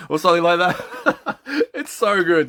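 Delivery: high laugh through the words. Real, not acted. Vlogger laughing about prank show, high laughing voice. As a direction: crack up while you talk.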